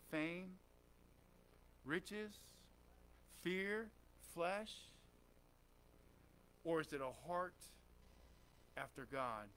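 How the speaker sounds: low, hushed searching question; quiet, probing altar-call delivery; soft preaching appeal. Real, not acted. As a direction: let each choice hang in long pauses. Searching question put to congregation.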